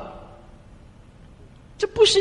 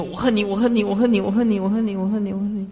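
echo fading; 5 semitones higher, faster than usual. Retold or imitated. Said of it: "那山谷就回应的".

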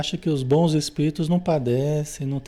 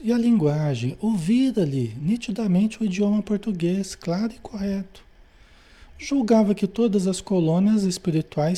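formal read-aloud, pitched up; book reading; 5 semitones higher than usual. A narrator's reading. Teacher reads André Luiz's account to students, formal book reading. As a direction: read the passage out clearly to a class.

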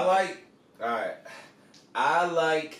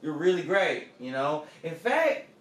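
Southern coach drawl. Pretend. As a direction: play a folksy Southern coach sizing up a recruit. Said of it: "Nick says".

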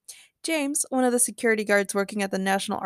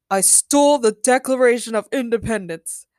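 mildly boastful announcement; proud declaration; 4 dB louder than usual. A narrator's reading. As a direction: declare it with pride. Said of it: "Ben proudly declared".

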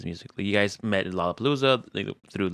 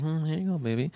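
soft tender; 6 semitones higher, 8 dB quieter than usual. Retold or imitated. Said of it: "kinda be like".

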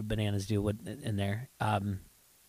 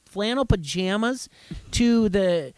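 worked up; 7 semitones higher, 6 dB louder than usual. Real, not acted. Ranting about cringeworthy cast member.

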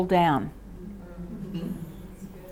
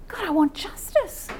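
demo prayer, higher; 8 semitones higher, 6 dB quieter than usual. Shown as a demonstration. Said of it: "start learning to do is".

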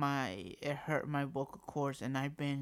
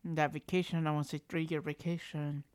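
ordinary delivery

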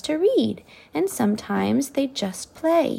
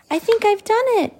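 high, fast, thrilled and loud; excited cry; 12 semitones higher, 6 dB louder, faster than usual. Acted. Sophie exclaims to herself, high excited cry. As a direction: burst out with joy, much higher. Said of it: "Sophie cried".

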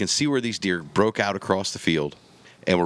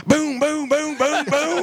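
very high, loud imitated shots in quick succession; frantic gunshots; 4 dB louder, slower than usual. Hunter emptying his rifle at the downed doe, frantic high booms. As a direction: blast away at the fallen deer in a panic. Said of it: "and I go".

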